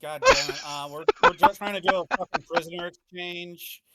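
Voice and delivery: high, loud frustrated yell; exasperated outburst. Acted. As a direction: vent sheer frustration.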